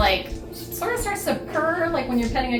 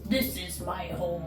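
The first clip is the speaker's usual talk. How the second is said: iguana voice; 4 semitones lower, 9 dB quieter than usual. Acted. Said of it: "This is in the, his, like, iguana voice".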